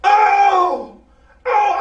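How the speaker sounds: loud, high cries of pain; pained high yelling; yelling like crazy. Retold or imitated. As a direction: howl out like a hurting boy. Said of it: "out there yelling like he's crazy".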